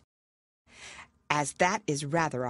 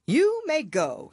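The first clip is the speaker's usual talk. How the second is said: slow royal dismissal; 11 semitones higher, 5 dB louder, slower than usual. Acted. King voiced.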